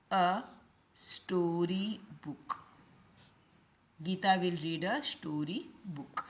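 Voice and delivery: gentle dictating teacher; slow, soft dictation. Shown as a demonstration. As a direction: spell out the answer slowly and softly. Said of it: "teacher models answer slowly".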